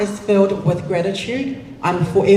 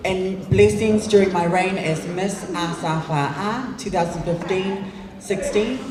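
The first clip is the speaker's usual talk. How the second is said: normal talking voice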